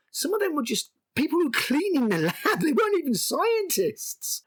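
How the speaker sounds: very high, squeaking disbelief; incredulous outrage. Real, not acted. Reviewer objecting that lab cleaners got murdered, incredulous. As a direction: let the pitch shoot up in protest.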